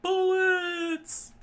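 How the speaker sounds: very high, loud squeal; falsetto shriek. Acted. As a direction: shriek it in panic. Panicked falsetto shout.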